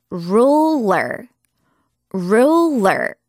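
"Ruler" stretched slowly over both syllables; careful model pronunciation. Shown as a demonstration. Teacher models a tricky word for students, "Ruler" said slowly and carefully. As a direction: draw out "Ruler" so the r and l are clear. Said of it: "이렇게 발음하면 돼요".

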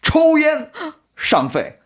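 sing-song lottery hawker pitch; slow, drawn-out street hawker patter; snake oil seller. Retold or imitated. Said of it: "약장수처럼 복권을 팔아요".

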